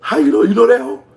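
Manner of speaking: real thrill at the gossip; fired off high and loud; excited disbelief. Real, not acted. Excited at viewer knowing her.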